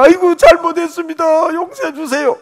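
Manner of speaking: high, far-off begging voice; pleading defendant. Retold or imitated. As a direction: whine for mercy, a hint of mock. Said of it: "재판장이 마음이 좋아가지고".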